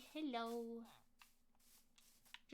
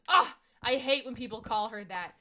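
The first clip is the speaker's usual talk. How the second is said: louder, laughing groan of irritation; mock annoyed mom; 2 semitones higher, 11 dB louder than usual. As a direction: play the irritated mother, half laughing. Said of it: "And she goes".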